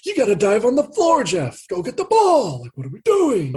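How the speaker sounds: imitating the coach demanding hustle; retold courtside shout. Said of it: "raising the voice".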